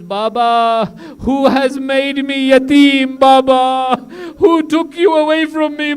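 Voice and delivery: put-on high, loud, slow wailing child's lament; keen over the head, a grieving little girl; Sakina's lament. Sakina holding her father's head, high wailing lament. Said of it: "One of the questions is".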